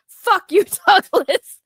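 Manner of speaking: loud, high, laughing outburst; mock scolding. Streamer cussing out a regular heckler, playfully loud. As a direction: yell it playfully through a laugh.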